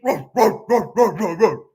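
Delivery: loud, gruff barking roars like a pack dog; mock war-dog roar. Military buddies as dogs, roaring.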